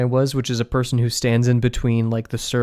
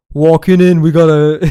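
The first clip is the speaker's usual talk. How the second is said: very loud, raised New Jersey ticket call; New Jersey boss accent; 4 semitones higher, 11 dB louder than usual. Retold or imitated. Boss reading tickets in the kitchen, loud attempted New Jersey accent. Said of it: "I can't do the accent".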